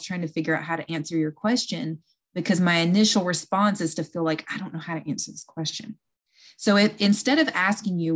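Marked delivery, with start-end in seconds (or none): 4.5-6.0 quick, quiet, flustered aside; flustered mumble. Retold or imitated. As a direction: rush it under your breath, unsure. Inner flustered thought voiced.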